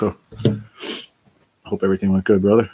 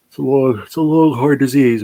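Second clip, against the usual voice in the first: emphatic; 5 semitones higher, 7 dB louder than usual. Real, not acted. Emphatic complaint about illness.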